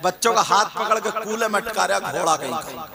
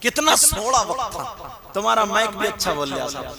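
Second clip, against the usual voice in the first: ordinary talk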